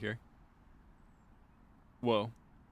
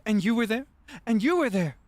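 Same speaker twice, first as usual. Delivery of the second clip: higher, louder Dorothy waking-up line, each there rising; tearful-sweet Dorothy, pointing out each friend; Dorothy telling her friends they were there, mock earnest; Wizard of Oz Dorothy; 8 semitones higher, 5 dB louder than usual. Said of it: "it's giving Wizard of Oz".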